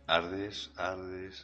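each word fading away like an echo; echo effect. Pretend.

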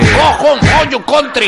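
loud, high mock Trump shout; Donald Trump. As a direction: bark it like a ranting Trump. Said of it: "ese Donald Trump".

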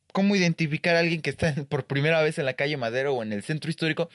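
normal talking voice

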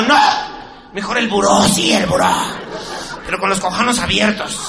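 high, loud, hammed-up woman's voice; mock woman demanding. Neighbour woman asking for the nightstand, loud and high. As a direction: shout it like a demanding lover.

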